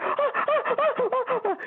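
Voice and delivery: very high laughing ohs, imitating the patient. Patient at a party giggling while feet are worked on, high laughing ohs.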